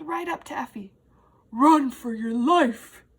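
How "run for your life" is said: loud, slow, out of breath; breathless warning, much louder; puffing caterpillar. A narrator's reading. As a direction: puff it out like a panicked caterpillar. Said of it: "he puffed".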